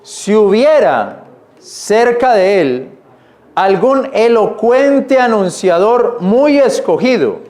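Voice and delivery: slowed, deliberate reread of the verse. Shown as a demonstration. Preacher rereads the verse for his church, slow and deliberate. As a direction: lean on every word as you reread. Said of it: "vuelvo a leerlo, mire".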